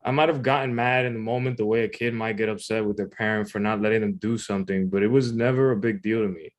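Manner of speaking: ordinary delivery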